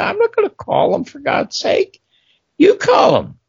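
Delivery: high, indignant squawk of a refusal; exasperated producer. Retold or imitated. Producer refusing to phone the hostile writer, high and exasperated.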